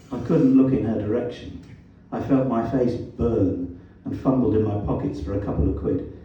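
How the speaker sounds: ordinary delivery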